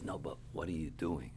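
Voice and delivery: low, softened repeat of a question; quiet, low imitation of an elder; soft-spoken rebbe. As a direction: quote the rebbe gently, voice dropped. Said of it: "he spoke very softly".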